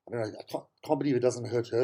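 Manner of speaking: ordinary talk, nothing marked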